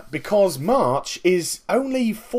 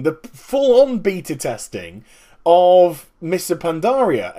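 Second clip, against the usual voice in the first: ordinary talk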